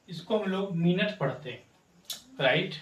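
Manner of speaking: usual speaking voice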